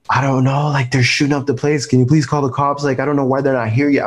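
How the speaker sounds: reliving the fear, begging a stranger to call the cops; retold panic. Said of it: "I'm just panicking".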